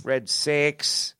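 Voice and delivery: a lightly voiced Boston accent saying "Red Sox" as "Red Six"; soft try at a Boston "Red Sox"; weak Boston accent attempt; bad Boston accent. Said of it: "we gotta work on our Boston accents".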